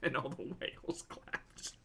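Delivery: slow, whispered sarcasm; deadpan it under your breath, slowly; sarcastic whisper. Real, not acted. Mocking Anna's whale story with a slow sarcastic whisper.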